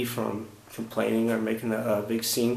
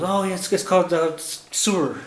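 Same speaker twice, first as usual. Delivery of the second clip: mock cop answer; 6 semitones higher than usual. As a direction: lightly imitate a cop making it up. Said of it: "And he goes".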